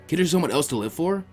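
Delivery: plain reading voice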